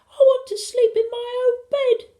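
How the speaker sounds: put-on very high, loud child's wail; high-pitched crying child; tearful little boy. A narrator's reading. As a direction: whine it through tears. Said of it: "the boys began to cry a bit".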